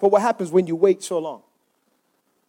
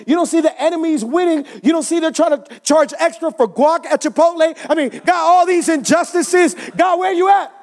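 high-pitched, indignant questioning of God; upset; 9 semitones higher than usual. Acted. Preacher as upset Habakkuk confronts God, indignant. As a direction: demand answers from heaven, voice high. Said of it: "was, was pretty upset".

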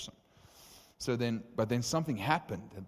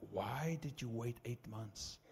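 slow, softer, wounded question; imitated upset disbelief; 2 semitones higher, 6 dB quieter, slower than usual. Friend turns to preacher after hours of prayer, imitated upset disbelief. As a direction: draw out the reproach, held back. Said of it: "He was, like, legitimately upset".

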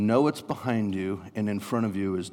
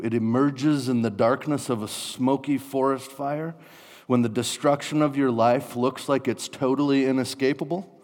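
normal talking voice

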